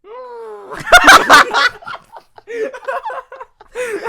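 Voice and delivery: loud high trumpeting call; elephant trumpet. Pretend. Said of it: "get into the elephant zone".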